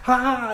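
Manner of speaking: high, breathy happy "ah" with arms up; a quick imitated cheer of joy; happy sigh of joy. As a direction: let out a soft delighted ah. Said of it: "Well, Happy, he's like".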